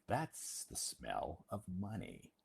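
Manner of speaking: slow, matter-of-fact answer; knowing reply. Retold or imitated. Local answering the visitor, slow and matter-of-fact. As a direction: tell them with a knowing calm. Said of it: "I say".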